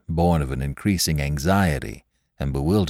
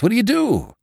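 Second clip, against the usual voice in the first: far higher, pleading repeat; more desperate. Acted. Drummer presses the men again, far higher. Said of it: "repeated question".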